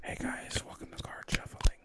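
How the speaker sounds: soft unvoiced whisper into the mic; ASMR whisper. Host greeting viewers in a mock ASMR whisper. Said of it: "a little A S M R".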